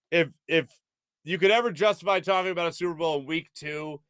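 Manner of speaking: usual speaking voice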